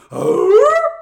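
loud high cartoon gasp; hammed-up gasp. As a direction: gasp like Scooby-Doo. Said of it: "That is a bad Missus Garrett Scooby-Doo".

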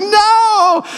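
high, drawn-out mock wail of refusal; protesting Addie. Dad retelling daughter refusing him, high childlike wail. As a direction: imitate a little girl crying out in protest. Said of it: "She's like".

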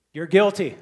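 emphatic loud pronouncement; loud declaration. Real, not acted. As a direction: land it like a gavel. Preacher declaring guilt.

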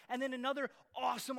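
normal talking voice

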